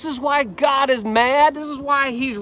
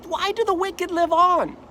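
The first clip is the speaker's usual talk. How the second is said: Job's pleading question; 7 semitones higher than usual. Acted. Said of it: "He says".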